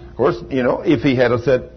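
normal talking voice

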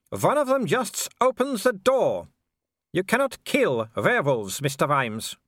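high calling taunt from a villain; Wulf. Acted. Werewolf calls across the river to Vimes, high taunting call. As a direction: call out high, swooping down at phrase ends. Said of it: "Wulf cupped his hands".

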